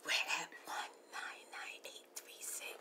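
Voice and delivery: unvoiced whisper of the count; ASMR whisper. Real, not acted. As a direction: whisper the numbers close to the mic.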